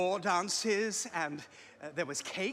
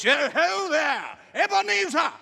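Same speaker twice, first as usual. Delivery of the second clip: put-on a jolly old master calling out; booming jovial old Fezziwig; fat jovial voice; 5 semitones higher, 6 dB louder than usual. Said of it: "comfortable, oily, rich, fat, jovial voice".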